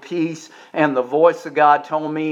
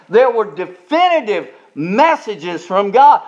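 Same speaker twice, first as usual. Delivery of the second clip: higher, ringing pulpit voice pressing the point; rising revival preaching; full-force preaching; 8 semitones higher, 3 dB louder than usual. Acted. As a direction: preach it hard to the congregation.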